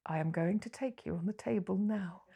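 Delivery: hushed, determined mock demand; quietly acted-out urgent desire; intent quiet urge. Acting out a sudden urge.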